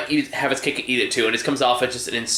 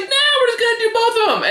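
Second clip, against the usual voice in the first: high, fast mocking filmmaker; 3 dB louder, much faster than usual. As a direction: toss it off in a silly high voice. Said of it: "but we're just like".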